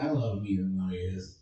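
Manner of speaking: normal talking voice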